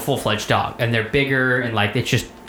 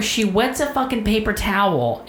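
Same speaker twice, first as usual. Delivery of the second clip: ordinary talk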